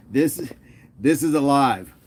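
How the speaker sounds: ordinary talk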